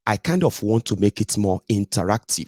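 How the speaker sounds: ordinary talk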